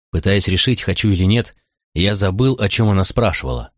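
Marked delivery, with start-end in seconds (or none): none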